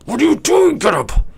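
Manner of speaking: high, loud shrieking orc yelling at the party; panicked orc. Acted. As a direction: screech at them to get up. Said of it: "He goes".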